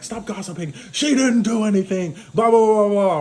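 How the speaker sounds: a groom losing it mid-speech, mock shouting at gossiping guests; mock outburst. Groom's wedding speech falls apart.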